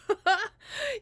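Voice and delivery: quick high burst of laughter; sudden laugh. Real, not acted. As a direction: laugh at her own slip. Laughs mid announcement.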